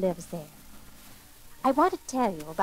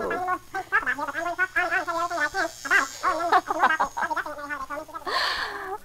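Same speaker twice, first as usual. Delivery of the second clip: high, garbled elf jabber; squeaky speed-talking tirade; angry rapid chatter; 5 semitones higher than usual. Acted. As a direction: sputter furiously in tiny, too-fast gibberish. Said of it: "You talk too fast".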